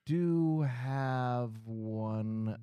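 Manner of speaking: drawn-out dread, loud then fading; sinking realization. Acted. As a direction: let it dawn very slowly, stretching each word. Dawning realization it is his cat.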